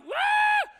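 high-pitched yelled wail; sudden squealed mock yell; mock screaming. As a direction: imitate a couple screaming at each other. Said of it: "yelling, fighting, screaming".

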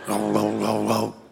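low, soft, grunted mumble; grunting teenager. Retold or imitated. Teen son grunts at dad's cheery good morning, low grunt. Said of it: "grunts and groans".

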